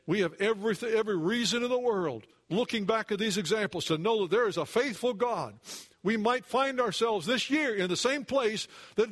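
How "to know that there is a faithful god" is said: usual speaking voice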